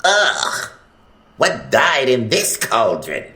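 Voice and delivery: cried out in disgust. Acted. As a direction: recoil from the stench. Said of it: "he cried out".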